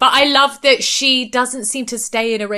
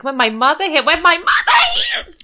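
rising from raised voice to high cracked shriek; hammed-up wailing; 7 semitones higher, 3 dB louder than usual. Imitating a cast member's meltdown about her mother, escalating wail. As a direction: imitate a hysterical wail building to a scream.

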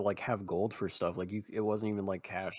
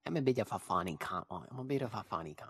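mock Aussie accent. Self-mocking joke voice.

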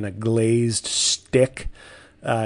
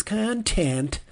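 the word "Content." said higher, with disgust; disdainful; 8 semitones higher than usual. Acted. Podcaster mocking the word content with a disgusted higher "Content.". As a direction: spit out "Content." like a dirty word. Jokes about hating the word.

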